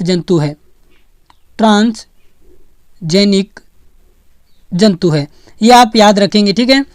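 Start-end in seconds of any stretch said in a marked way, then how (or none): 1.6-5.3 very slow, drawn out, with long pauses between parts; slow dictation. Shown as a demonstration. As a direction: spell out the term piece by piece for students. Teacher repeats key term for memorising.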